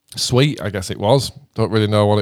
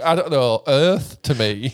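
normal talking voice

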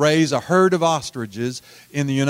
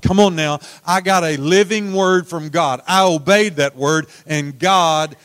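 rising, louder and louder preacher's climax; full-force preaching; 4 semitones higher, 7 dB louder than usual. Acted. Preacher driving home the message to the congregation, building to a loud climax. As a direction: build it to a shouting peak.

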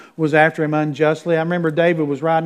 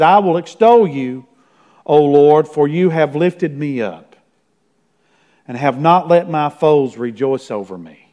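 louder, ringing psalm reading; scripture reading; 4 dB louder than usual. Acted. Pastor reads a psalm to his congregation, ringing out.

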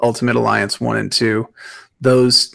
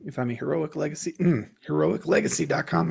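hoarse, fading voice; losing voice; 4 semitones higher, 8 dB quieter than usual. Real, not acted. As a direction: voice giving out mid-sentence. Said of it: "I'm losing my voice".

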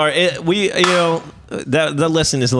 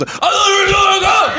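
very high, drawn-out mock shout; mocking her dad impression; 4 dB louder than usual. Friend mocks her retelling of dad's joke, hammed-up high yell. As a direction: exaggerate her uncharming yell, much higher and slower. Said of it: "Her dad's much more charming than she just".

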